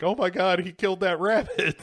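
high-pitched mock outrage; shocked test audience. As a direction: gasp it like an appalled viewer. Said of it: "test audiences were like".

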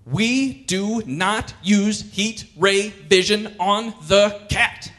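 hammed-up parental lecture; stern parent. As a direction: lay down the law word by word. Parent scolding super child.

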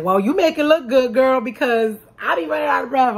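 imitating a gushing fan. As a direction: play the fan hyping her up, loud and high. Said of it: "They're like".

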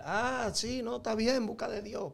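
high-pitched mock agreement; dismissive bandmates. Voices the dismissive bandmates' reply.

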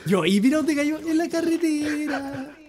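high, loud disbelieving shout; shocked yell. Real, not acted. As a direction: cry out in disbelief, drawn out. Shocked at where it landed. (grito de incredulidad)

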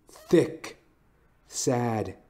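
ordinary delivery, nothing marked

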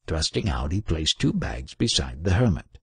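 ordinary delivery

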